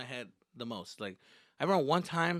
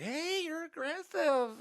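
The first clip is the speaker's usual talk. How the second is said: put-on high, slow girl voice; drawn-out, high-pitched mock protest; imitated girlfriend; slower than usual. Girls' reaction to his advances acted.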